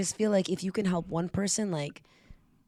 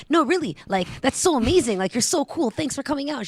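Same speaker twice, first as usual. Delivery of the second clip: much higher and louder, insistent; gushing praise; 10 semitones higher, 7 dB louder than usual. Retold or imitated. To a shy newcomer, high insistent gushing praise. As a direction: pile on the praise, bright and eager.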